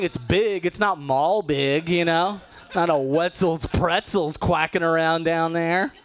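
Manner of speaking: normal talking voice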